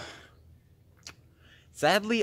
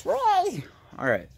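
sarcastic cheer. Real, not acted. Sarcastic cheer about webs.

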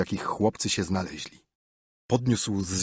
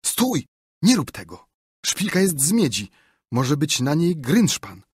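higher-pitched cry to stop; urgent raised-pitch exclamation; calling out; 6 semitones higher, 4 dB louder than usual. A narrator's reading. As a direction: call out a quick warning. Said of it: "gdy Tomek zawołał".

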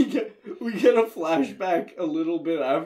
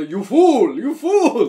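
high, loud shouted insult; mock screaming; 7 semitones higher, 9 dB louder, slower than usual. Retelling the film characters screaming at each other, mock shouting. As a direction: holler it like a hammed-up film quarrel. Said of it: "they're fucking screaming at each other".

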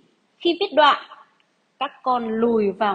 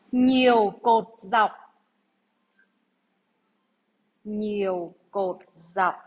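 slow, deliberate dictation read; 2 semitones lower, slower than usual. Acted. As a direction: read each phrase out for pupils to write. Teacher reads dictation to class.

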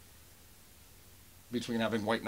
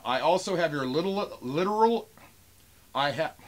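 higher, louder mock-angry reading; Junkman; 6 semitones higher, 5 dB louder than usual. Vlogger tripping over a buyer's threat, sounding like Junkman. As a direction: stumble loudly through an irate message. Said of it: "I sound like Junkman now".

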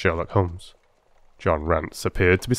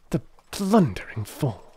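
bitter; 7 semitones higher than usual. A narrator's reading. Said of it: "Holmes said bitterly".

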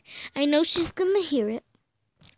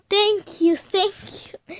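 relieved thanks; 6 semitones higher, 3 dB louder, slower than usual. Real, not acted. Relief after escaping.

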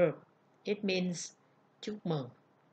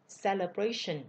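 "Celebration" stressed as cele-BRA-tion; stress on third syllable. Shown as a demonstration. Teacher repeating the noun for learners, "Celebration" stressed on the third syllable. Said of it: "ngữ điệu nhấn ở vần ba".

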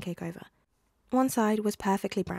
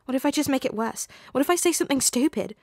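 higher, louder, panicky babble; anxious teen blurting fears, pitched up; rising panic; 6 semitones higher, 5 dB louder than usual. A narrator's reading. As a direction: spiral into frightened babbling. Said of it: "eyes widening as a slow horror crept up his throat".

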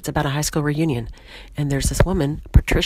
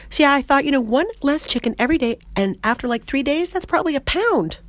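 animated, higher-pitched sketch character; lively comic monologue voice, often pitched up; Patricia, chatty and proud; 5 semitones higher than usual. Acted. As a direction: tell it eagerly as a proud oversharer. Plays Patricia telling her weight-loss story.

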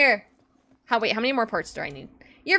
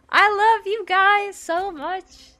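slow sincere; 8 semitones higher, much slower than usual. Real, not acted. Thanking chat during hype train.

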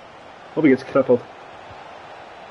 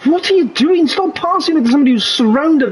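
frustrated yell; 12 semitones higher than usual. Real, not acted. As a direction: yell at useless teammates. Yelling at AI teammates in game.

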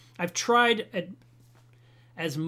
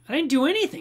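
high, loud, defensive denial; indignant protest; 10 semitones higher, 8 dB louder, faster than usual. Retold or imitated. Customer protesting to the vice president, loud and indignant. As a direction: insist you're innocent.

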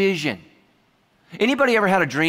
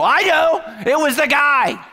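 high, loud comic yell; mock outburst; 10 semitones higher, 6 dB louder, slower than usual. Husband answering wife's murder show, high mock yell. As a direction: blurt it out in mock alarm.

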